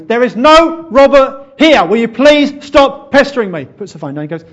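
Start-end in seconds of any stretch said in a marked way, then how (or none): 0.0-1.9 slow, high, furious yell; angry shout. Acted. Stranger to a pestering wrong-number caller, angry shout. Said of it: "Son, that's anger". 1.9-3.6 high, furious yelling; angry shout. Acted. Stranger who has had too many calls, angry shout. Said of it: "Son, that's anger".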